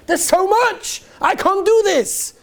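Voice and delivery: high, slow overwhelmed sigh of a line. Retold or imitated. Student overwhelmed by all the tasks, high despairing. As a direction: give up, pitched high and slow.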